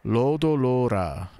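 "Lodo lora" slow, both "lo" syllables held; long vowels. Shown as a demonstration. Trying Japanese road roller aloud, drawn-out "Lodo lora". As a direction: stretch the o's in "Lodo lora", pitch lifted. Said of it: "that would also be a long vowel".

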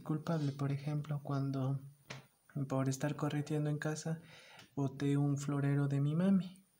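usual speaking voice

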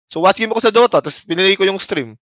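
usual speaking voice

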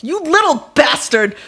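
high, slow, loud furious yell. A narrator's reading. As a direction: yell it at the culprit. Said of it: "she yelled".

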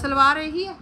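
loud, high call to the helper. Real, not acted. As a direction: call it out so she hears. Showing suit to helper across room.

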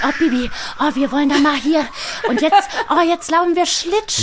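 put-on high, loud giggly girl; excited child voice. Tina voice calling to Bibi while ice skating, giggly and excited. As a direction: squeal with joy on the ice. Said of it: "und dann sagt man".

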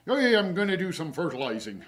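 hammed-up English country gardener voice. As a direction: play up a rustic English gardener. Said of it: "like an English country gardener there".

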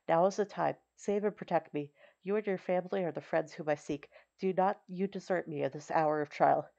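usual speaking voice

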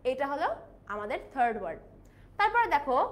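normal talking voice

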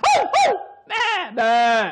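high, loud animal calls; imitated sheep bleats. Shown as a demonstration. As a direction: bleat loudly like a flock of sheep. Mimicked animals in film scene.

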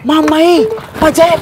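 high, loud yelp of alarm; loud startled exclamation; alarmed cry. Acted. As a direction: cry out as you spot the pursuer. Student alarmed at spotting pursuer.

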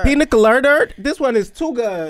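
high delighted squeal; excited. Real, not acted. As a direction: gush over the drink. Excited tasting.

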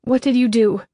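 tense, alarmed question; trying not to quiver. A narrator's reading. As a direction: hold down rising dread while asking. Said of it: "I try to keep my voice from quivering".

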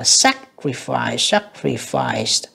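"sacrifice" slowly, SAC strong and the rest falling; drawn-out model of "sacrifice", leaning on SAC; first-syllable stress. Shown as a demonstration. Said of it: "âm một nha". ("sacrifice" nhấn âm một, đọc chậm)

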